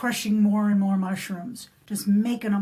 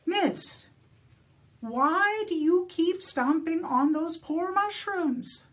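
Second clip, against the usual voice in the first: leprechaun voice; 6 semitones higher than usual. Acted. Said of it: "And finally he said".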